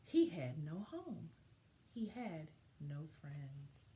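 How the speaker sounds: gentle, expressive picture-book narration; reading to children. A narrator's reading. Teacher reading a story to her class, storybook voice. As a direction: tell it like story time for young kids.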